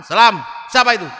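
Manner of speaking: gruff shout. Acted. Umar shouting at visitor.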